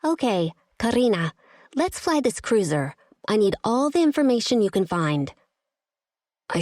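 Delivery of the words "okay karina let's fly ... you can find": determined. Acted. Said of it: "with a clear, determined tone".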